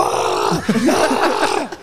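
hammed-up open-throated yell, repeated; mock holler from a man just punched; imitated cry of pain. Wrestler reacting to the punch, exaggerated retold scream.

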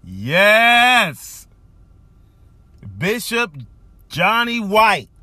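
loud, high joyful shout of his name; excited shout. Real, not acted. Host cheering his bishop back to the pulpit, loud and high. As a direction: hail the bishop at full voice.

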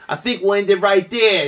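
high, loud mock sign-off; mock nervous host. Quoting the host ending the interview, high and loud. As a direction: rush out the goodbye. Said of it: "He was like".

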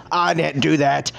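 normal talking voice

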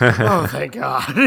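slow, drawn-out relief; relieved sigh. Retold or imitated. Voicing his daughter's relief as the heroes arrive, slow and drawn out. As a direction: breathe it out like a weight just lifted.

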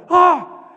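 sudden loud, high gasped "ah!"; explosive mock gasp; startled cry. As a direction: imitate her shock with a burst. Acts woman's startled reaction.

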